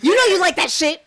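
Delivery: loud teasing outburst. Real, not acted. Co-host teasing after cracking up, loud laughing outburst. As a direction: burst out laughing and yell it teasingly.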